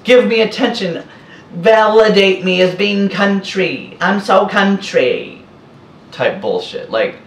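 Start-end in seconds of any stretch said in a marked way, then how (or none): 0.0-5.4 higher, hammed-up needy poser voice; mocking attention seeker. Mocking a woman in the photo craving attention, hammed-up high voice. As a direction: sneer at her craving for validation. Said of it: "That just screams".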